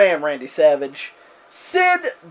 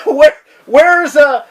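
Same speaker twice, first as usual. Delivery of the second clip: mock Heenan; 5 semitones higher, 6 dB louder, much slower than usual. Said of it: "Bobby Heenan's like".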